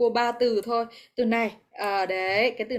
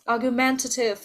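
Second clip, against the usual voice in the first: "argumentative" with the beat on MEN; third-syllable stress. Shown as a demonstration. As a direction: model argu-MEN-tative for "argumentative". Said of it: "nó nhấn ở âm ba em ạ".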